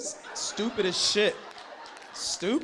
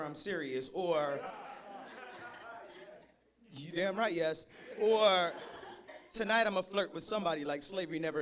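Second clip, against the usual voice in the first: soft pickup lines; 3 semitones lower, 6 dB quieter than usual. Acted. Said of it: "This poem is called".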